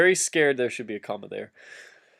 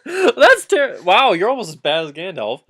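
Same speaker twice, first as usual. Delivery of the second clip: laughing character line; 5 semitones higher, 6 dB louder than usual. Acted. Frodo comment, laughing.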